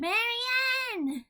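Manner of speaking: high, drawn-out call of a name; high-pitched mock holler; mock yell. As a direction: call out as if yelling, without the volume. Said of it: "Well, yelling".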